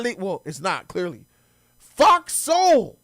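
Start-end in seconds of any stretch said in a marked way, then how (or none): 1.8-3.0 high, loud scoff; incredulous. Real, not acted. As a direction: spit out the name in disbelief. Outraged at the clip.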